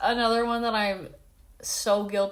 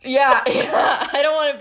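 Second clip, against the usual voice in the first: laughing; 5 semitones higher, 6 dB louder than usual. Real, not acted. Laughs at racist joke.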